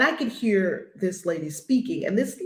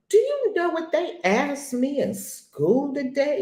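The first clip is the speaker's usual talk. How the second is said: put-on higher, slow child voice, put out; indignant little girl; 8 semitones higher, slower than usual. Granddaughter complains to her mother, in a higher, offended child voice. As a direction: play the offended granddaughter telling her mom. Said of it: "she was highly indignant and insulted".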